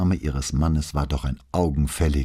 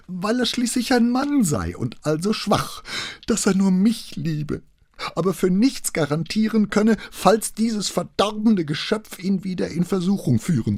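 much higher, worked up; agitated. A narrator's reading. Wife repeating her husband's confession, agitated and much higher. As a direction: voice climbs as she gets upset. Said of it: "Sie war jetzt dermaßen erregt".

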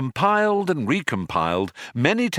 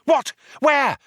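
high, loud, urgent questions; startled cry; 5 dB louder than usual. A narrator's reading. Stranded man twists round to find the switch, startled cry. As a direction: fire out two high, eager questions. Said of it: "cried Arthur".

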